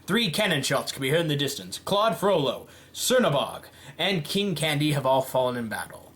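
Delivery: ordinary talk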